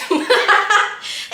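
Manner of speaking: loud, high real cackle; bursting laugh. Real, not acted. Laughing at her own dramatic accident, bursting laugh.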